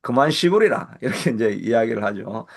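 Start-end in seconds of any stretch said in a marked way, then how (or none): 0.0-1.0 씨부리라 in firm Gyeongsang dialect, a bit louder, falling; Gyeongsang dialect scolding. Retold or imitated. Pastor quoting a Gyeongsang scolding, louder dialect retort. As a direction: snap 그만 씨부리라 like a Gyeongsang elder telling someone to shut up. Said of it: "경상도에서는 그렇게 하죠".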